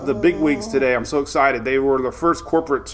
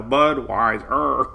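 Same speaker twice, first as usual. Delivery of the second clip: croaking frogs; 2 semitones lower, much slower than usual. Retold or imitated. Recalling how the frogs croaked the brand name in the ad. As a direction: croak it out one syllable at a time. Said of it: "And all of a sudden".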